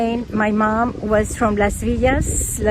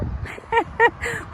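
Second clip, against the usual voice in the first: high giggle; 10 semitones higher, 4 dB quieter than usual. Real, not acted. Laughs at own cat joke.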